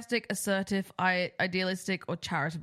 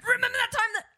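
excited interrupter; 11 semitones higher than usual. Retold or imitated. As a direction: gush like someone cutting in. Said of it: "I'm like".

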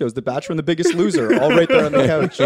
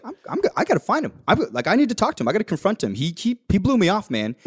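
fast, high, stammering urgency; hurried, higher-pitched fretting; mock agitation; 4 semitones higher, faster than usual. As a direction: imitate a worked-up friend tripping over words. Acting out worked-up friend on mission.

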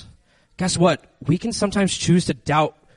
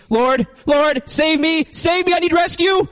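much higher, pleading, hammed-up terror; desperate cry for help; 12 semitones higher, slower than usual. Peter sinking in the waves, much higher mock pleading. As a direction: beg to be saved while sinking. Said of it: "he's just like".

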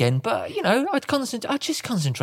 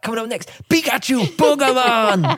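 hammed-up Geordie kids' TV link; Geordie TV presenter; 8 semitones higher, 3 dB louder than usual. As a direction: stretch the last word out in loud Geordie. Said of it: "S M T V Live".

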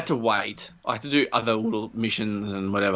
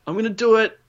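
high excited showman voice; eager inventor voice; 8 semitones higher than usual. Retold or imitated. Imitating the inventor starting his demo, high and eager. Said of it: "so he's like".

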